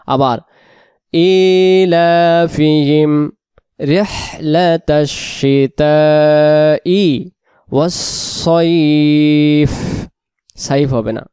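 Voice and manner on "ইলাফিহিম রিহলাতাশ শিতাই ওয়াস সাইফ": slow drawn-out tajweed recitation; measured Quranic chant. Shown as a demonstration. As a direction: recite each word slowly and carefully. Recitation of the verse.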